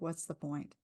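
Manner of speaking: soft, a hint of defeat. Shown as a demonstration. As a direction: let it slip out quietly. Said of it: "Sometimes I feel like".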